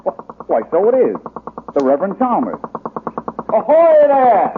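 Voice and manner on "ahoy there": loud, high shout across the water; hailing call. Acted. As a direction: call out to the boat. Hailing a boat.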